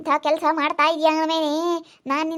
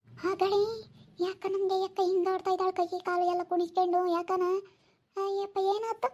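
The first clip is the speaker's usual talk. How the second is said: a neighbour asking anxiously what happened, pitched up and hushed; worried neighbour woman; 3 semitones higher, 8 dB quieter than usual. Acted. Neighbour woman wonders at possession. (ಮೇಲಿನ ದನಿಯಲ್ಲಿ ಮೆಲ್ಲಗೆ ಕೇಳುವ ನೆರೆಮನೆ ಹೆಂಗಸು)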